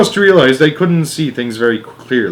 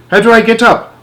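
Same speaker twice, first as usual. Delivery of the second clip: panicky; 11 semitones higher, 6 dB louder than usual. A narrator's reading. Boy stuck floating mid-air.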